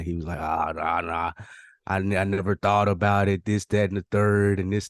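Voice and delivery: ordinary delivery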